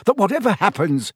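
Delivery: hurried, insistent plea. A narrator's reading. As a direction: push the words out, time is short. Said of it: "talking more quickly still".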